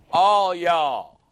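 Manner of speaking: loud Southern "All y'all" as the punchline. Shown as a demonstration. Preacher landing the Dallas lunch joke, loud. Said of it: "That's the way they say it in the South".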